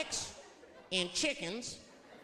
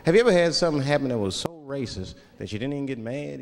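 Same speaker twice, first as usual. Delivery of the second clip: much louder, hammering the crowd; big loud setup question; loud rhetorical question; 4 semitones lower, 10 dB louder than usual. Real, not acted. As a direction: put it to the audience full volume.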